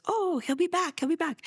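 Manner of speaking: high, light voice for the gardener saying he'll be back; playing the gardener kindly brushing it off; gardener reassuring. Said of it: "He goes".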